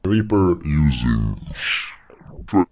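like a tape machine losing power; tape stopping. Shown as a demonstration. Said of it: "to showcase it even better".

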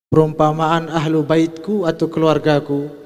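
normal talking voice

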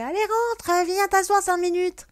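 very high, loud pushy invitation; imitating the woman. Stranger inviting her inside, high pushy coaxing. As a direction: sing it out bossy and sugary. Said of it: "elle me fait".

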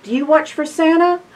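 ordinary delivery, nothing marked

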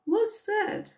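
high voice muffled inside a tree. A narrator's reading. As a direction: sound like it's from inside a trunk. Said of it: "a muffled voice".

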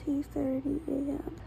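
hushed late-night whisper. Real, not acted. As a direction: whisper it so no one wakes. Late night clip, quiet.

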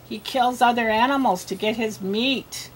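ordinary talk

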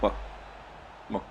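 normal talking voice

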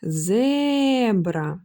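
"зебра" with a long held "зе"; drawn-out calling tone on "зе" in "зебра". Shown as a demonstration. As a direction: call "зебра", stretching the first syllable. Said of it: "Кличемо".

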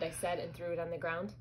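plain reading voice

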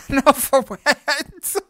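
laughing hard while talking; words bursting with laughter. Real, not acted. Laughing at own story.

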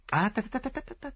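high rattled-off dismissal; impatient tutting. A narrator's reading. Detective brushes off his friend's answer, impatient. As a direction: cut the friend off with rapid high tuts. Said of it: "said Poirot impatiently".

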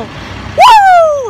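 high, loud cheer; excited whoop. Real, not acted. Vlogger cheers before reacting to the new music video, loud. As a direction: let out a joyful yell. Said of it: "I'm super excited".

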